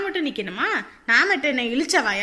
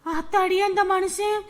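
panicked wail; 5 semitones higher than usual. Acted. Daughter-in-law dreading the man's return, panicked wailing. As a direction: lament it like disaster is coming.

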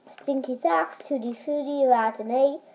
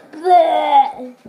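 loud disgusted retch; gagging blech; 3 semitones higher, 7 dB louder than usual. Real, not acted. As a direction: gag on spoiled milk. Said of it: "making me gag".

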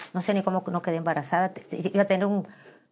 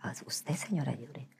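soft suitor's whisper; hushed, low acted come-on; whispered flirting; 4 semitones lower, 12 dB quieter than usual. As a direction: whisper it like an admirer. Plays flirting man.

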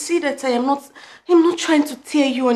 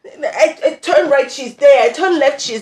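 stammering, loud and high, punching each phrase; exasperated rant; 4 semitones higher, 7 dB louder than usual. Acted. Girlfriend fed up with his ever-present mother, exasperated outburst. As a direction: build a frustrated tirade, louder on each repeat.

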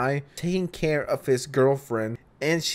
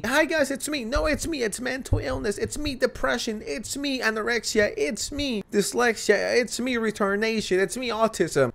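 high, airy, chirpy self-introductions; mock alter introductions; 7 semitones higher than usual. Commentator mocking TikTok alters, high chirpy intro voice. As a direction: play each illness as a cheerful little character saying hi. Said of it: "alters introduced themselves as if".

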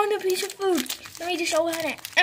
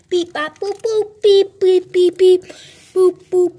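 much louder, higher robot beeps and boops; 4 semitones higher, 10 dB louder than usual. Pretend. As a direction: blare out robot noises. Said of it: "stupid beep boop robot".

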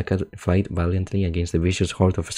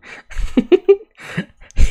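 high squealing burst of laughter; shrieking laugh; 9 dB louder than usual. Real, not acted. Streamer cracks up at the AI story, shrieking laugh.

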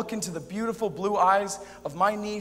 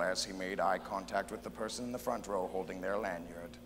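very low, hushed storyteller voice; super narrator; 10 semitones lower, 7 dB quieter than usual. Acted. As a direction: narrate deep and soft like a documentary voiceover. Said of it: "what if God is like a super narrator".

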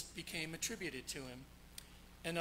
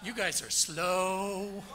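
teasing; 2 semitones higher, 8 dB louder, much slower than usual. Real, not acted.

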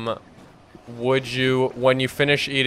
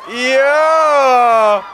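excited shout; 13 semitones higher, 8 dB louder than usual. Real, not acted. Host cheers contestant's answer.

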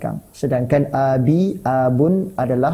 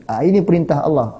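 usual speaking voice

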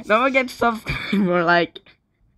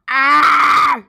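long, high, loud yell; frustrated scream; 7 semitones higher, 8 dB louder than usual. Real, not acted. Player losing control of the game, screaming. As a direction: scream "ah" at full voice. Scream after repeated swearing.

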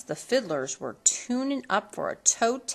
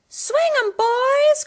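very high, loud whoop; Cindy calling the dancers; cried out; 9 dB louder, slower than usual. A narrator's reading. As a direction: holler to the dancers. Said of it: "cried Cindy".

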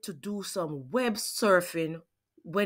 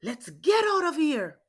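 yelled; 7 semitones higher than usual. Acted. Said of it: "I yelled".

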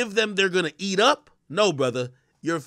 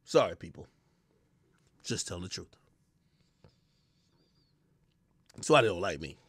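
low murmured aside; quiet aside; 13 semitones lower, 10 dB quieter, faster than usual. Real, not acted. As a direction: mutter it under your breath.

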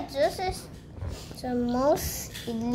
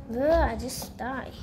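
ordinary talk, nothing marked

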